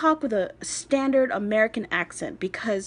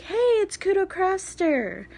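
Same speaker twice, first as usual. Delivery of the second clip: bright high greeting like a channel host; youtuber intro greeting; 10 semitones higher than usual. Pretend. Mock intro to her viewers, high and bright. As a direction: pitch it up like a video intro. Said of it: "introduce myself like".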